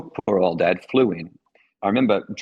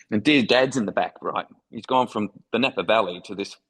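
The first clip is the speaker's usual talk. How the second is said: usual speaking voice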